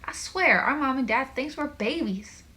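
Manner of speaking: high, loud exasperation; exasperated gripe. A narrator's reading. Logan gripes about mom and dad, exasperated. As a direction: complain with a loud, high kick-off.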